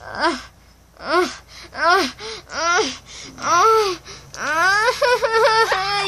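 string of rising high yelps; panicked yelps. Real, not acted. Boy watching friend in danger, rising panicked yelps.

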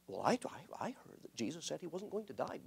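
hushed reply spreading the rumor. Retold or imitated. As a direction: pass on the rumor under your breath. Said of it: "in hushed voices".

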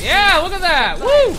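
very loud, high-pitched whoop; ecstatic cheer. Real, not acted. Gamer celebrating a kill, ecstatic. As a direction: yell it high, ending in a whoop.

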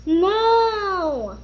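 high upset no; a childlike cry of dismay. Acted. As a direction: wail it in dismay. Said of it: "she said".

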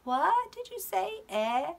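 high, puzzled "Eh? What did you say?" of someone who can't hear; playful deaf-ear act for the preschoolers; hard-of-hearing elf. Acted. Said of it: "like he can't hear very well".

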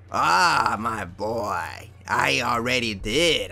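low, slow sinister villain voice; Jafar gloating. Retold or imitated. Retelling the Aladdin tape, the villain gloats low and slow. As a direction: purr it out like a smug cartoon villain. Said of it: "they have, like, sound effects like".

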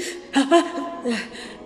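bitter laugh. Real, not acted. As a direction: laugh in disbelief at the pain. Bitter laugh mid-rant.

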